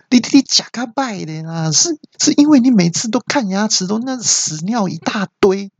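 high-pitched indignant rant, easing toward the end; sputter, then rail at the caller like the nurse; imitated outraged cry. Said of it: "听了之后就大叫说". (学护士高声气急败坏地大叫)

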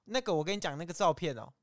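normal talking voice

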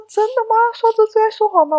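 高声假装吃惊; 夸张地装傻反问; 打哈哈装惊讶. Retold or imitated. 打哈哈回老板. (面试时对老板打哈哈，高声装惊讶)

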